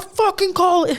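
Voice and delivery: half-laughing angry order to stop calling; annoyed yell. Retold or imitated. Snapping at callers who keep phoning work.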